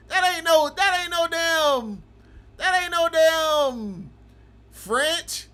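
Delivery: loud, high, slowed-down disbelief; incredulous yell. Real, not acted. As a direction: drag it out in outrage. Streamer mocks chatter's French claim.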